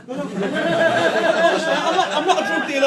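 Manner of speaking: real laughing protest; laughing denial. Real, not acted.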